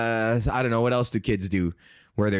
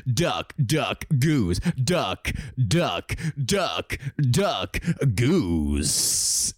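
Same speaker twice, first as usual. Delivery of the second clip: hammed-up radio announcer, every word punched; over-the-top broadcaster delivery; super radio voice; 2 semitones lower, much slower than usual. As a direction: boom it out like a radio DJ. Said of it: "like, a super radio voice".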